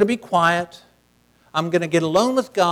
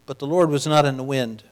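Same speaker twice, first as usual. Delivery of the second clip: ordinary talk, nothing marked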